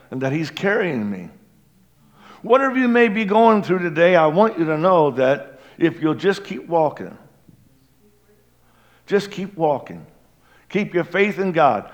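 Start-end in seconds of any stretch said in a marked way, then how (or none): 2.4-11.9 rising fervent exhortation; preaching. Acted. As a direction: urge them on with fervor.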